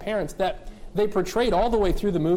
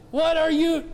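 sharp, high irritated snap; irritated parent; 11 semitones higher, 4 dB louder than usual. Retold or imitated. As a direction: snap back at the child. Irritated parent snapping back.

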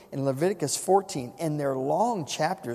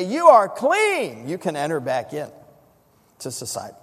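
proclamation; 5 dB louder than usual. Acted. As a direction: declare it ringingly as the priest. Priest's pronouncement voiced in sermon.

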